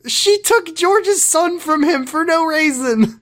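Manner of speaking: high, slow, indignant complaint; outraged rant. Real, not acted. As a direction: squeal it out in disbelief at her. Ranting about a TV villain.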